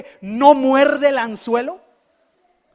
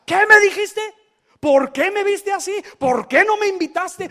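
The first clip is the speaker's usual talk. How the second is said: raised, indignant questions fired off; offended demand; 7 semitones higher, 3 dB louder than usual. Retold or imitated. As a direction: play the hurt, offended friend confronting. Offended person confronting.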